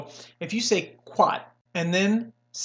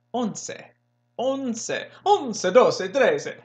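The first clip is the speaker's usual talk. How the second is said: "once" sung out higher, Italian-style; Italian-sounding; 6 semitones higher, much slower than usual. Shown as a demonstration. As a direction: play with an Italian lilt. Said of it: "more Italian".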